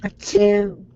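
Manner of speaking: big fake sneeze; loud sneeze. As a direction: sneeze loudly. Character sneezes in game.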